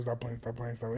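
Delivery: low, very soft repetition; hushed self-scolding. Real, not acted. Host settling down for silence, hushed. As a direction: tell yourself off almost under your breath. Trying to get serious.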